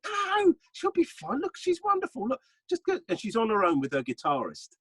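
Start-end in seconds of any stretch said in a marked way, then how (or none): 0.0-3.0 much higher, light coaxing push; encouraging. Retold or imitated. Urging Jason Manford to ask for the photo, coaxing and high. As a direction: egg a nervous friend on. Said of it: "I went".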